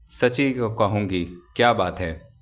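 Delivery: plain reading voice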